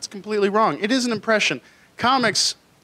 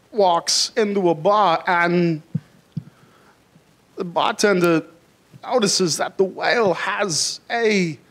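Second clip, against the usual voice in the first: slow, halting lightly voiced Walken; a weak, drawn-out Walken impression; bad Christopher Walken; slower than usual. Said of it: "an impression of Christopher Walken telling his favorite joke".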